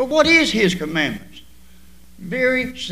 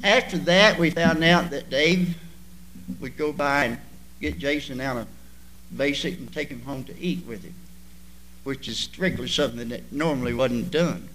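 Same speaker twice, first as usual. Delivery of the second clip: ordinary delivery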